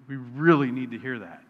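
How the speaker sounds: ordinary talk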